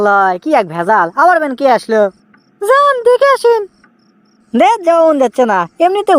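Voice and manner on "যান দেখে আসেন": high, slow put-on woman's voice; wife's voice. Wife sending husband to the door, in a high, slow woman's voice. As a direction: draw it out slowly, pitched up high as the wife. Man voicing his wife.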